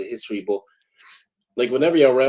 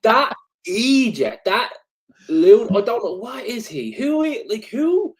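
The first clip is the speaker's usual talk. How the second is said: louder and much higher, exasperated sputtering; worked up; 8 semitones higher, 4 dB louder, slower than usual. Real, not acted. Fired up about the wrestling post, high exasperated sputter. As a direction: rant in disbelief, almost spluttering. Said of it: "let me calm down".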